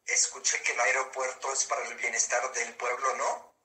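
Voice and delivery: text-to-speech computer voice; even, machine-read delivery; synthesized voice. Real, not acted. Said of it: "esta es una voz sintetizada". (voz sintetizada por computadora)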